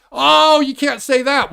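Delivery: high, loud pearl-clutching whine; hammed-up gasping complaint; mock whiny voice. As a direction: parody the offended critic. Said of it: "and say".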